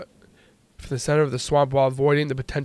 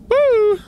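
high, loud "woo"; excited whoop; 6 dB louder than usual. Real, not acted. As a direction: let out a high, sustained whoop. Cheers after upgrading in game.

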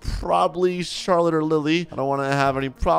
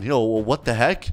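normal talking voice

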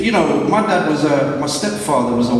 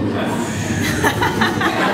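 high laugh; 13 semitones higher than usual. Real, not acted. Laughing at own dramatic read.

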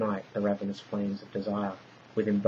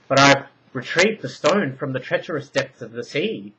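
louder, higher protest; heated argument; 5 semitones higher, 8 dB louder than usual. A narrator's reading. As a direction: argue back hard. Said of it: "Potaniah argued".

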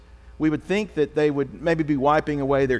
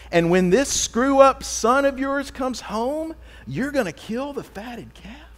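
put-on older brother's complaint; 4 semitones higher than usual. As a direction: let the grievance swell until it bursts. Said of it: "He's saying".

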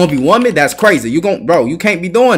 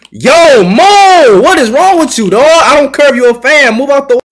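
loud, high shouting at a player; loud annoyed telling-off; angry yell; 7 semitones higher, 6 dB louder than usual. Real, not acted. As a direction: yell him out of the way. Yelling at player blocking him.

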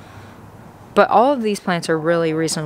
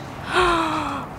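sharp gasp of delight; 8 semitones higher, 6 dB quieter than usual. Real, not acted. Shopper gasping at a plant, delighted. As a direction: gasp as if struck by beauty.

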